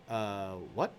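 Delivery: low, soft, puzzled what; clueless Matt. Retold or imitated. Matt on the phone, low and bewildered. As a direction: play it dazed and confused. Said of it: "And he goes".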